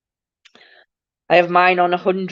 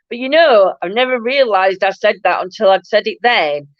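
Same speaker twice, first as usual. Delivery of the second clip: normal talking voice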